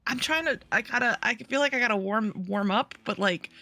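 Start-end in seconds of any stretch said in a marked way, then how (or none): none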